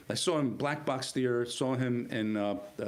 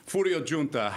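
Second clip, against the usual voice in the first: hint of an Italian accent, a bit louder; 3 semitones higher, 4 dB louder than usual. Newcomer reading his character's name at the table, lightly Italian. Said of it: "Once I did the voice".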